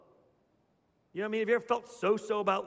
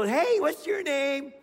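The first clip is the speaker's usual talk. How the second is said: put-on high, slow, drawn-out little-girl voice; chatty little girl; 8 semitones higher, 5 dB louder, slower than usual. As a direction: imitate a child making friends mid-game.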